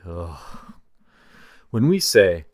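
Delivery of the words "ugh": a soft, drained "ugh" of reluctance; weary groan. Shown as a demonstration. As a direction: sigh it out like you dread cooking. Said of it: "That can feel like".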